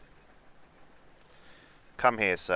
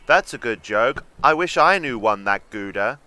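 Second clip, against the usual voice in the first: louder mocking reply; 8 dB louder than usual. Shown as a demonstration. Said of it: "I think I'd have gone with".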